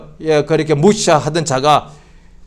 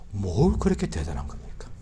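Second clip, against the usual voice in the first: quiet question; 10 semitones lower, 14 dB quieter than usual. Acted. Sermon question, quiet then loud.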